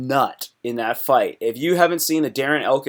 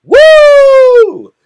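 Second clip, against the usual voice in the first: shrieked "Woo!" high and very loud, hammed up; imitating wild cheering; 15 dB louder than usual. Streamer imitating a hyped fighter, screaming "Woo!" very high and loud. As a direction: mimic a fighter whooping wildly in the cage. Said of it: "he starts just doing things like going".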